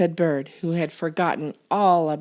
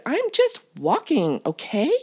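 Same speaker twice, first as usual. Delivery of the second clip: annoyed; 8 semitones higher than usual. A narrator's reading. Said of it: "shouted Bird".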